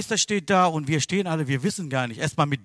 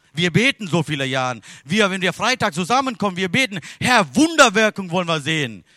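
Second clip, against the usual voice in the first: loud, raised preaching voice; forceful preaching; 6 semitones higher, 7 dB louder than usual. Real, not acted. Preacher pleading with congregation for miracles, forceful preaching.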